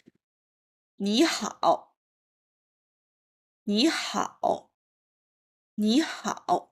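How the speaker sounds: 你好 said slowly with clear tones; slow clear Mandarin model. Shown as a demonstration. Teacher modelling 你好 slowly for beginners to repeat. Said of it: "I will repeat them three times".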